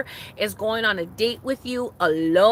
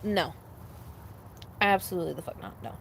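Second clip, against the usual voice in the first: usual speaking voice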